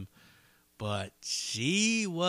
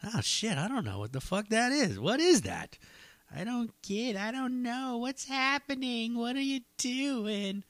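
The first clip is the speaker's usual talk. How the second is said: higher, confused mock panic; flustered inner voice; 11 semitones higher than usual. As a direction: replay the baffled thought, pitched up. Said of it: "pause and be like".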